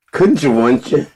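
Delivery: high, loud taunting singsong; teasing singsong. Acted. Taunting a friend in a high loud singsong. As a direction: needle them with a sing-song.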